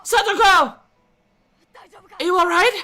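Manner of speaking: high, loud worried call; urgent call. A narrator's reading. Brother calling to his sister. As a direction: call out in alarm.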